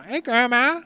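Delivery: put-on high, loud little girl voice; child voice. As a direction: squeak it like a cheeky kid. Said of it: "She says".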